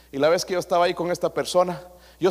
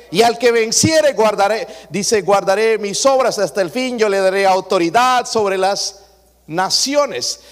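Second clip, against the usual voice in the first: louder, rousing pulpit delivery; full-force preaching; 3 semitones higher, 5 dB louder than usual. Acted. Pastor exhorting his congregation about Christ's reign, ringing out. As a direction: proclaim it to the whole church.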